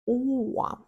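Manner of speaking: usual speaking voice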